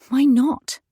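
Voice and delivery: a sudden spark of resolve; defiant thought. A narrator's reading. Wife deciding to stand up to husband, defiant rise.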